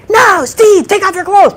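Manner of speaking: high, loud barked order; commanding shout. Shown as a demonstration. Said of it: "So if I say".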